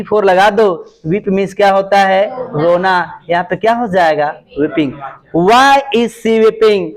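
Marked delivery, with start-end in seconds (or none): 5.4-6.8 slow, spaced words; slow classroom dictation. Shown as a demonstration. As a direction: dictate slowly for pupils to write. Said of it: "slow dictation of English".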